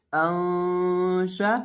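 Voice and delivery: अंशा chanted very slowly with a nasal hum on the noon; drawn-out recitation. Shown as a demonstration. Teacher reciting the joined syllables for students, slow chanted ghunna. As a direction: hold the noon of अंशा in a long sung ghunna.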